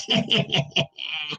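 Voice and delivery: soft snicker up her sleeve; a muffled sly witch giggle; witch's hidden snicker. A narrator's reading. Witch hiding her laugh from Jerome, soft snicker. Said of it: "She was laughing up her flapping sleeve".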